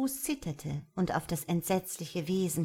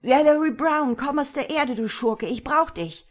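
loud, raised-pitch summons; shouted, imperious summons; loud angry call; 7 semitones higher, 6 dB louder than usual. A narrator's reading. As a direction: call him out as loudly as you can. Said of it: "rief so laut er nur konnte".

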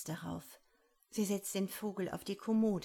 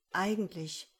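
plain reading voice

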